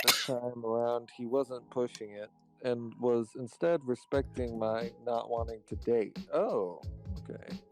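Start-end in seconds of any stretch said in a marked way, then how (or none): none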